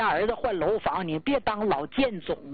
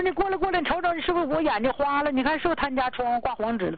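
higher, fast alarmed call to the husband; fast, higher, anxious "你看是不是他家窗户挂黄纸了"; urgent alarm; 5 semitones higher, faster than usual. Retold or imitated. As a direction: hurry him over to look. Urgently calling husband to the window.

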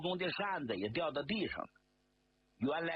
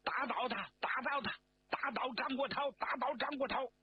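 高声齐喊口号; high, shouted chant of rage; 愤怒大嚷; 11 semitones higher than usual. A narrator's reading. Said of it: "因为盛怒突然大嚷". (众人怒吼)

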